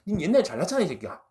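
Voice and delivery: high, loud mock accusation; punchy imitation of an angry challenge; resentful reproach. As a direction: act out soldiers blaming their general. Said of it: "원망을 하고 있어요".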